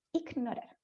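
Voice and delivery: "Ignorar" softly, g hardened to K; ultracorrected G. Shown as a demonstration. Teacher repeats the typical error, quiet overcorrected "Ignorar" with K. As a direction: say "ik-norar" under your breath. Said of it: "exagera mucho algún fonema".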